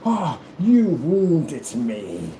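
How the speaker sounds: very slow, theatrical wounded cry; hammed-up wounded dignity; Frazier getting mad. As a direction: draw it out like an affronted gentleman. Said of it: "When he get mad".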